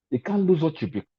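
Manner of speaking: ordinary talk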